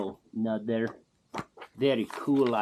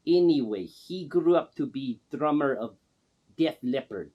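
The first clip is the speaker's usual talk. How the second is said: ordinary talk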